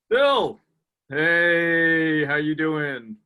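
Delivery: put-on loud hearty hello to an old pal; excited greeting. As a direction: greet him like a long lost buddy. Fake greeting on phone.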